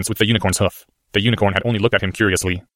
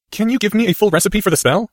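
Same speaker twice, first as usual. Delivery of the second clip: put-on young boy; 12 semitones higher than usual. A narrator's reading. Harry voiced.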